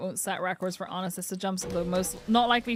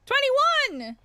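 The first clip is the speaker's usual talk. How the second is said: high, loud real whoop; excited cheer; 6 dB louder than usual. Real, not acted. Streamer cheering her top seventy-five percent, loud and high. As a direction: celebrate out loud. Thrilled at her contribution count.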